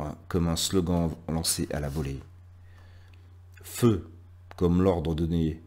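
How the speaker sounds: declaimed poem, pauses between lines, lists that build and fall back; lecture de poème. Acted. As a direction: recite with a strong attack on each new image, then settle. (poème déclamé, scandé, avec de longs silences)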